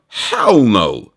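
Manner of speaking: ordinary talk, nothing marked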